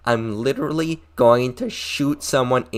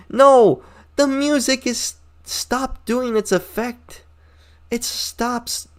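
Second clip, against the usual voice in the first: high-pitched frustrated cry; exasperated outburst; 12 semitones higher, much slower than usual. Real, not acted. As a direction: burst out in disbelief. Ranting about idol music.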